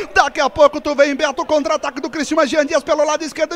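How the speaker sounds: high, rising urgency; excited play-by-play. Real, not acted. Commentator calling a counter-attack for a second goal, high and urgent. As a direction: call the attack as it unfolds.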